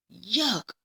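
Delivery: ordinary delivery, nothing marked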